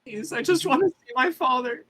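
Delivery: put-on high, small voice of a scared boy; pleading child voice. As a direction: beg like a frightened little kid.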